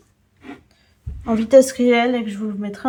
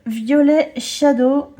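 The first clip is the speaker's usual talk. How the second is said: English accent; 3 semitones higher, 6 dB louder, slower than usual. Shown as a demonstration. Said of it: "Mon accent irréprochable, hein, n'est-ce pas?".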